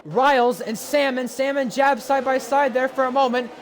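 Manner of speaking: motorsport commentator. Real, not acted. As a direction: call the battle live, building energy. Live race play-by-play.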